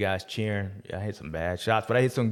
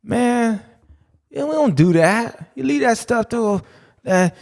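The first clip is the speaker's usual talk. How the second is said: higher mock-told quote; 6 semitones higher, 5 dB louder, slower than usual. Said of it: "Man, I told him".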